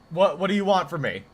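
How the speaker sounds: usual speaking voice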